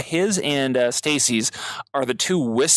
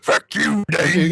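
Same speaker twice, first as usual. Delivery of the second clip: orc voice; 4 semitones higher, 4 dB louder, much slower than usual. Retold or imitated. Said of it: "It's like".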